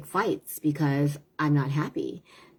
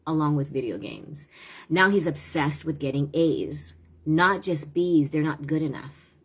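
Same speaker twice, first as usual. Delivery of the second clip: ordinary talk, nothing marked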